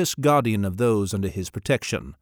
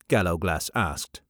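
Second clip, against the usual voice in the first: ordinary delivery, nothing marked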